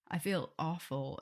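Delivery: normal talking voice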